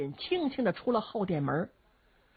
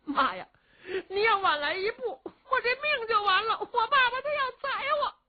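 crying, much higher; son crying. A narrator's reading. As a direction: cry out to mother in relief. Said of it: "罗成也哭了".

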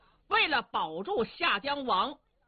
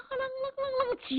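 sound effect. A narrator's reading. Voicing the hooves and spear sounds.

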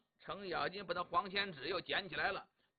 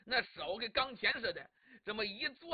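plain reading voice

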